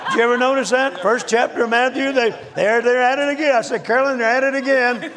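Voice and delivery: they're at it again, half laughing; laughing exasperation. Retold or imitated. Retelling his exasperated discovery.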